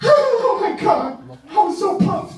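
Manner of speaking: high, slow gushing excitement; breathless high-pitched thrill. Real, not acted. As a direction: gush like a starstruck fan. Recalling thrill at the convention.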